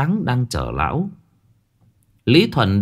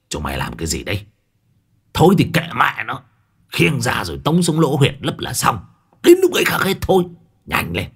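put-on old man's hiss; 2 semitones higher than usual. A narrator's reading. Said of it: "lão rít lên".

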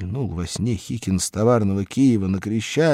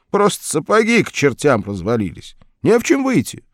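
higher and louder, lightly aggrieved worker; Hikin complaining; 7 semitones higher, 7 dB louder than usual. A narrator's reading. Hikin complains to the stranger about his boots, mild whine. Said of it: "ответил Хикин".

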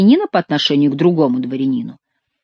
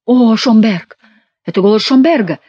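higher, louder glad outcry; excited cry; 5 semitones higher, 5 dB louder than usual. A narrator's reading. Minions recognise their friend's voice, excited cry. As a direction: call out in sudden recognition. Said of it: "закричали трое миньонов".

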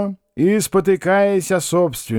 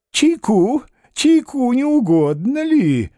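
put-on a higher, eager old man's invitation; fussing old man; 10 semitones higher, slower than usual. A narrator's reading. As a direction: hurry him inside with tea. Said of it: "бормотал старик".

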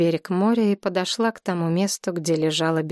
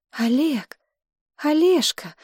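high, confused calling of his name; bewildered; 8 semitones higher, slower than usual. A narrator's reading. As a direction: call him, lost and rising high. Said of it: "произнесла она растерянно". (растерянно, голос взлетает вверх)

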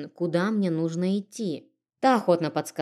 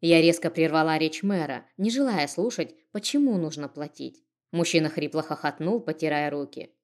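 usual speaking voice

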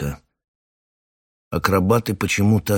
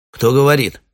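demanding; 6 semitones higher, 6 dB louder than usual. Acted. Captain demands over radio.

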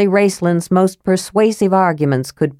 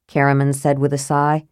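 ordinary delivery, nothing marked

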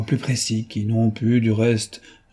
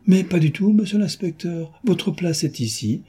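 exclaiming; 7 semitones higher than usual. A narrator's reading. The judge stops the inspector leaving, high eager exclamation. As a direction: call the inspector back insistently. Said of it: "le juge s'écria".

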